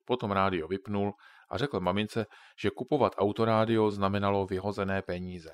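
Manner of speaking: ordinary delivery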